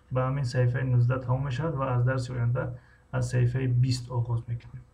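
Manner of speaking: ordinary talk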